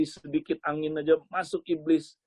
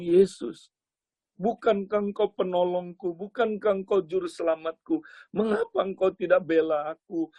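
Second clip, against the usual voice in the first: higher-pitched, acted reproachful plea; aggrieved pleading; 4 semitones higher than usual. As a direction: protest like someone let down by a protector. Young man protests to Jesus. (keluhan memelas dengan nada tinggi)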